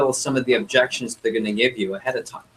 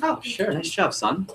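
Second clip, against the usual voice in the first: high, softly voiced dad giving in; pleased dad role; 8 semitones higher, 4 dB quieter, slower than usual. Dad says yes to his son's car request, high and soft. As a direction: agree readily, pitched way up and soft.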